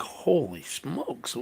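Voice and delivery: stunned murmur. Retold or imitated. Retold shock at Lego prices.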